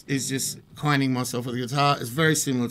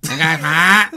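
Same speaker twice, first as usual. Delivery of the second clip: higher, louder honking voice that cuts through; barky voice; 4 semitones higher, 9 dB louder than usual. Shown as a demonstration. Singer demonstrates his barky, duck-like voice, loud and high. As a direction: bark it out like a duck to cut through a crowd. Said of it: "my voice is a bit barky".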